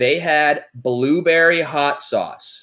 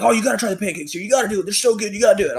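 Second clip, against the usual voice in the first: higher-pitched pushy friends imitation; eager urging; 9 semitones higher, much faster than usual. Friends talking him into trying the pancakes, mimicked eager urging. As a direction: egg them on, mimicking excited pals.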